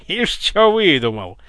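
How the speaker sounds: loud, drawn-out mocking cry; loud jeer. A narrator's reading. Guard scoffs at the impostor, loud jeer. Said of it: "заржал Пётр".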